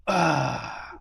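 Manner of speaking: usual speaking voice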